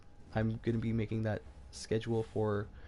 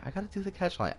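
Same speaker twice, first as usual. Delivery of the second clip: much higher, whiny complaint; squeaky mock whine; 13 semitones higher than usual. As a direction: squeak it like a reluctant kid. Repeats line in squeaky whine.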